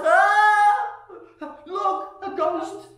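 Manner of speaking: high, loud scream into alarmed words; panicked shout. Acted. Disciple spotting a ghost in the storm, mock panicked shout. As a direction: shriek it like a hammed-up fright. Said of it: "someone shouts".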